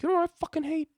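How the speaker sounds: very high, loud, strained outburst; mock angry rant. Angry guy on stage to the crowd, mock rant. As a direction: parody an angry comic ranting, pitch way up. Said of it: "anger issues with unawareness are very unappealing".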